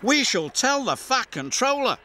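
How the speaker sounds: cross passengers threatening to tell the Fat Controller; indignant threat. A narrator's reading. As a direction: threaten to report him.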